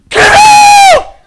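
very high, very loud "Get out!"; hammed-up screaming command; all-out shout. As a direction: scream it at the demon. Disciples shouting at demon.

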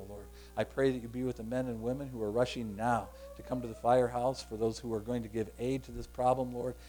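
low, soft, even praying voice; earnest prayer. Real, not acted. Pastor praying for those rushing to help, earnest prayer.

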